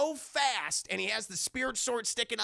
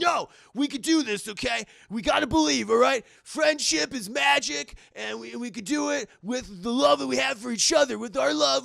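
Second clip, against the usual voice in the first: loud, raised-pitch heroic rallying; rally your friend with emphatic conviction; hammed-up anime friendship speech; rousing pep talk; 3 semitones higher, 6 dB louder than usual. Hero rallies friend to fight.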